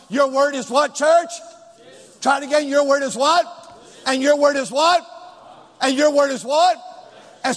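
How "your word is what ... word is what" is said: ordinary talk, nothing marked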